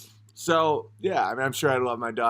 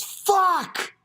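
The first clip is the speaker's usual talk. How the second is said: high-pitched anguished shout; sudden mock yell of dismay; brother freaking out. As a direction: imitate a gutted, furious outburst. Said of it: "He just freaked".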